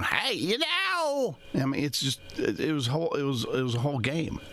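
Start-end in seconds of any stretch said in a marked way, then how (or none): 0.0-1.4 high, slow, stretched-out character voice; exaggerated high holler, drawing out the words; Festus. Radio host contrasts actor with his western character, high drawn-out mimicry. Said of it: "Instead of going".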